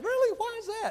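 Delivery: very high, loud disbelief; preacher amazed at free coffee, very high and loud; surprised. Retold or imitated. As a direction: leap up in pitch, amazed.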